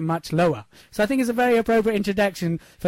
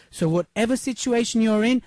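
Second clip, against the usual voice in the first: normal talking voice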